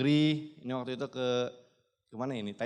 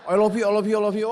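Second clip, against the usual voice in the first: higher, louder, gushing "I love you" over and over; gushing spam of love; 6 semitones higher, 9 dB louder than usual. Retold or imitated. Son imitating his mother's excited spam of love, gushing. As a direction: gush "I love you" like a mother flooding the chat. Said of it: "saya di spam chat".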